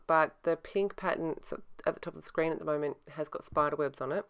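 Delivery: ordinary delivery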